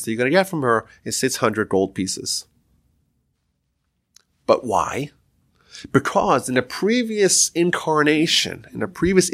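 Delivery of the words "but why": hushed, breathy why; voiceless puzzled whisper; whispered question. Acted. Husband asks why, whispered.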